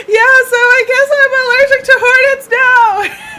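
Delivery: very high, loud tearful wail; hammed-up hysterical blubbering; mock crying. Stung driver explaining to a stranger, mock crying wail. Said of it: "I just start crying".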